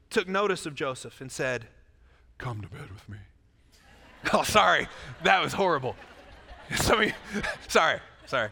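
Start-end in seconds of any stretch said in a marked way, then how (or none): 2.4-3.2 hammed-up low, hushed come-on; seductive baritone woman. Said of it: "She's a baritone". 4.2-8.5 loud, embarrassed laughter through the words; laughing apology. Real, not acted. Laughing at own bad impression.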